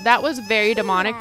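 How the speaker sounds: ordinary talk, nothing marked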